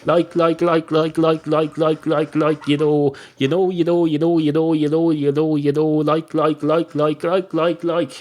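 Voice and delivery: mock youngsters with rapid, evenly chanted filler words; relentless singsong loop of verbal tics; young people's filler talk. Mocking how youngsters talk, chanted on and on without a break. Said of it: "That's how the young people speak".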